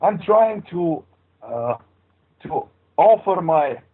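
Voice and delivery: thick put-on Transylvanian accent; hammed-up Transylvanian count accent. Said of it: "my incredible Transylvanian accent".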